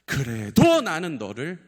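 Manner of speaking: slow, loud declaration as God; God's imagined cry, drawn out. Acted. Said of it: "이렇게 외치시는 것만 같았어요".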